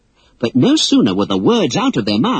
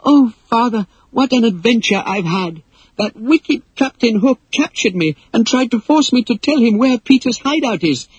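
much higher, bright breathless relief; joyful girl; 11 semitones higher than usual. Acted. Daughter telling her father her adventure, overjoyed. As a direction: tumble it out, thrilled to be home. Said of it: "threw her arms joyfully".

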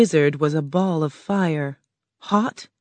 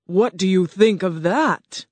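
roaring; 3 semitones higher, 4 dB louder than usual. Acted. Oz challenges lion.

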